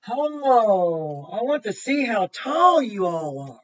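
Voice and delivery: normal talking voice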